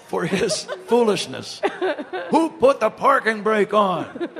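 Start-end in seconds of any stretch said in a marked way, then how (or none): 2.3-4.3 mock-furious demand, higher and slow; Ron Santo, furious. Broadcaster retells partner blaming him, mock fury. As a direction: imitate an indignant complaint, drawn out. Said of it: "He's furious at me".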